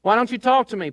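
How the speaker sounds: Pilate demanding. A narrator's reading. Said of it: "Pilate demanded".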